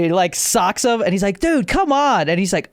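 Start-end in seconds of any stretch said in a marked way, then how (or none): 1.4-2.3 high-pitched mock pleading; exasperated pleading. Storyteller voices a man calming his drunk friend, exasperated. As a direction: imitate a fed-up peacemaker.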